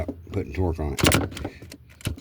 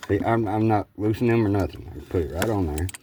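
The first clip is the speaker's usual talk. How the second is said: cigarette in mouth. Real, not acted. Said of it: "Even though I got a cigarette in my mouth".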